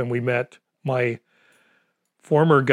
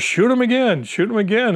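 urgent shout; 9 semitones higher than usual. Retold or imitated. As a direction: push him to fire again, fast. Ron urging during the shot.

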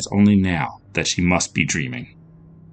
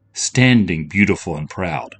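ordinary delivery

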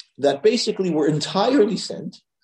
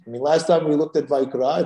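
normal talking voice

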